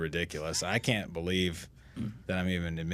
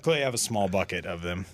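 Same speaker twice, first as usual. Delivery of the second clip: ordinary talk, nothing marked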